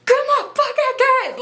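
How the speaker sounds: very high, loud mock screech; strained, exaggerated yell; hammed-up screaming. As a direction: act out a furious struggle for the keys. Acted fight over car keys.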